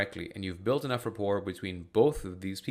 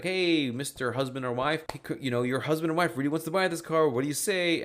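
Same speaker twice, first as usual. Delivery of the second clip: higher, louder eager sales pitch; pushy car salesman; 6 semitones higher, 4 dB louder than usual. Retold or imitated. Dealer pressing the spouse to buy the car, mock pushy pitch. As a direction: lay it on like a car dealer closing a sale. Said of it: "that person is gonna be like".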